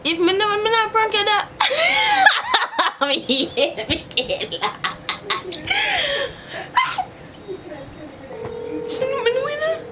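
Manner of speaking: high giggly protest; can't stop laughing while denying. Real, not acted. Laughing denial to friend on call.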